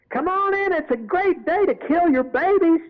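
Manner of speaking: high, loud Southern devil barker call; devilish Southern barker. Retold or imitated. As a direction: hawk it like a carnival devil in a Southern accent. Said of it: "I was in character as the devil".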